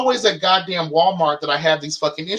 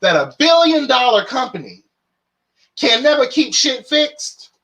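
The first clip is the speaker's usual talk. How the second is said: indignant rant; 4 semitones higher than usual. Retold or imitated. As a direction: let the outrage build and spill. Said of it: "I was like".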